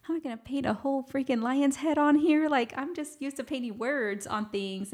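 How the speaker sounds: high-pitched, overwhelmed protest; acting out being thrown by a challenge; daunted disbelief. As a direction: retell it as a daunted, how-on-earth complaint. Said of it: "I felt so challenged".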